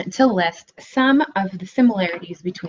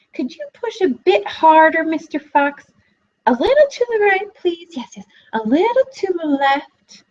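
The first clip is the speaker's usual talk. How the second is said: piglet voice; 9 semitones higher than usual. A narrator's reading. Piglet directing the massage.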